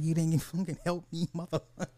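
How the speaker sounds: laughing mock accusation.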